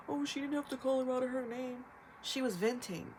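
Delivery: lightly mocking whiny critic voice; a touch of sneering imitation of commenters. Mimicking online critics who scold her.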